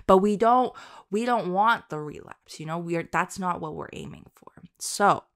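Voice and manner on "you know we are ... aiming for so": ordinary talk, nothing marked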